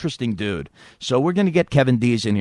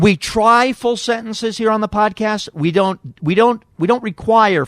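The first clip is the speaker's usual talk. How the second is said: raised, lofty show-host tone; hammed-up stuffy host correcting his co-host; mock pompous; 9 semitones higher, 4 dB louder than usual. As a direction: lecture him with fake grandeur.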